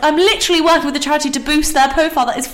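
exasperated, high-pitched protest; indignant retort. Retold or imitated. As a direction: retell your reply in rising, incredulous indignation. Retelling her indignant reply to messager.

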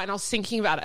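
normal talking voice

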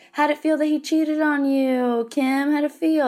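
hammed-up pushy paparazzo calling out; mock paparazzi shout. Imitating paparazzi taunting a celebrity, mock yelling. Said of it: "och skriker typ".

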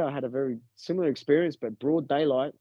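ordinary talk

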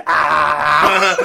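loud, drawn-out "aah" yell; big boisterous holler; imitated friendly roar. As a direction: roar in a pal's face.

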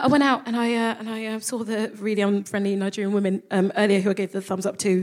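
usual speaking voice

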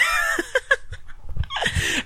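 long breathy high giggle. Real, not acted. Host giggling after his retort. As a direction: dissolve into giggles.

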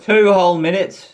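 high, loud disbelief; mock surprise. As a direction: act shocked at the wait. Mock outrage at a delay.

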